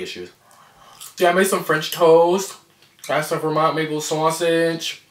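talking between bites; chewing while talking. Real, not acted. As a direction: mouth partly full. Seen 0: Puts greens in mouth at -1; lips work, chewing through words.

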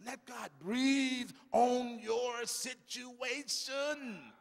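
very slow, stretched preaching; solemn slowed sermon line; slow drawn-out. Acted. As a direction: stretch every word.

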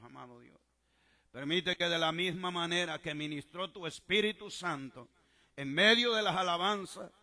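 loud, impassioned pulpit prayer building in force; preacher's full-voiced petition to God; fervent prayer. Real, not acted. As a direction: pray aloud over the congregation, rising in intensity. Leads congregation in fervent prayer.

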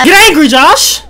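high, loud goading yell; shouted pep talk; hyped-up urging. Real, not acted. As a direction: egg your teammate on at full volume. Hyping up a teammate.

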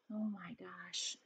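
a breathless whispered gasp; shocked whisper. Acted. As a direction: whisper it as if scared for the Wumps. Teacher reacts to story.

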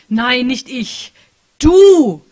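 builds from slow and firm to a huge high yell on Du; furious shout. Acted. Patricia hammering the word into the keys, furious shout. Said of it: "hämmerte sie wütend".

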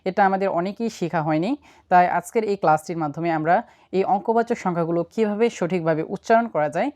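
normal talking voice

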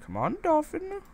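sudden jump way up in pitch to coax the dolphin; high coaxing call. Pretend.

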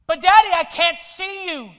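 put-on boy on the roof screaming to his father. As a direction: scream down into the smoke. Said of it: "the boy screams out".

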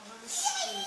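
high mocking kid voice. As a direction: squeak it like a small kid. Imitates a kid on voice chat.

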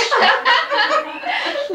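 high, bright laugh breaking out; burst of laughter. Real, not acted. Reader laughs at own story.

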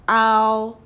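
ow stretched and loud for the class; teacher models the ow sound, loud and long; drawn-out ow. Shown as a demonstration. Teacher models ow for pupils, loud and stretched. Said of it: "We are looking at shout right now".

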